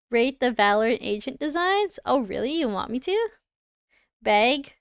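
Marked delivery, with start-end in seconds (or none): 4.2-4.7 "Beg" with a long E-like vowel; accented "bag". Real, not acted. Streamer reading a word she says badly, accented vowel. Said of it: "I say it with an E".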